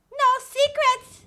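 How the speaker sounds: put-on high, loud child voice; squeaky kid greeting.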